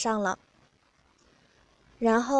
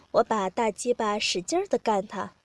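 plain reading voice

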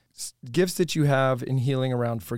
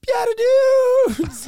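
high, drawn-out shout imitating a kid; excited kid shouting; 7 dB louder, much slower than usual. Kid runs up to Father on retreat, excited high shout. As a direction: squeal it out loud and long like a little kid. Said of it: "three to ten-year-old kid walk up and say".

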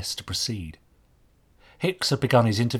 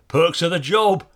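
louder, higher Cockney quip; jovial Cockney; 7 semitones higher, 7 dB louder than usual. A narrator's reading. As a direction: joke like a Cockney market worker. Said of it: "offered the other with a laugh".

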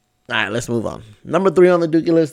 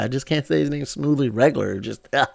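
usual speaking voice

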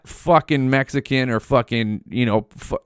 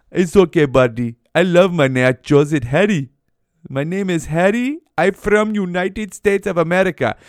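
higher-pitched, hammed-up Indian accent; mock Indian accent; 5 semitones higher than usual. Clerk insisting his name is Harry, mock Indian accent.

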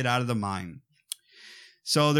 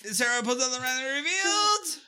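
high, drawn-up suspense build; hammed-up build to the unmasking; dramatic reveal; 11 semitones higher than usual. Repeats the mock reveal.

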